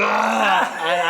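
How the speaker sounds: loud high roar; battle yell. Retold or imitated. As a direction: roar as you rip your shirt. Ripping shirt off, yelling.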